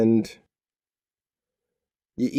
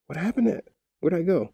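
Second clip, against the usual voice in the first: high, softish puzzled voice; small, lost character voice; bewildered little figure; 6 semitones higher, 3 dB quieter, faster than usual. Acted. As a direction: pipe up as someone left behind. Forgotten figure voiced in story.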